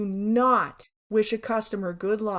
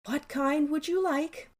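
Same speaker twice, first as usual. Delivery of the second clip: slow, higher-pitched weary service voice; sighing patience; 5 semitones higher than usual. Retold or imitated. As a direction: ask slowly with forced patience. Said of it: "you'd sigh and say".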